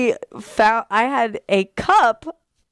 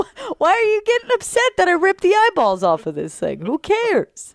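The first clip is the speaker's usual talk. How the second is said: pitched-up indignant puppy; defiant dog voice; 9 semitones higher than usual. Retold or imitated. Owner voicing her dog about ripped eyeballs, pitched-up defiant. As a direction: voice the dog shrugging it off, high.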